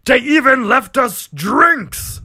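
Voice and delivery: drawn-out, high, loud orc shout; orc yelling. Acted. Orc captain holding up the oil, excited yell. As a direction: crow it at full orc volume. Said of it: "he yells, holding up the oil".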